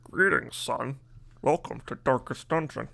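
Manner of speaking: a light put-on dad voice greeting his son; lightly playing his father welcoming the kid; impression of his dad. Streamer imitating his own dad greeting him, lightly voiced. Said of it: "that's my impression of my own dad".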